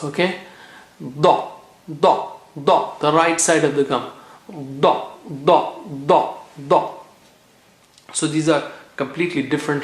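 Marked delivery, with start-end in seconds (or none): 1.0-6.9 da repeated with the tongue tip on the gums; Arabic da drill. Shown as a demonstration. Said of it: "touch it to the gums".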